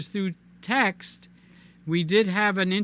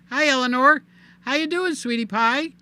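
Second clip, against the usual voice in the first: cooing greeting; 6 semitones higher, 4 dB louder than usual. Pretend. Greeting camera like a pet.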